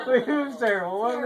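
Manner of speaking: laughing it out, high and loud; real laugh through the words. Real, not acted. Remembering the clowning with mom, laughing loud.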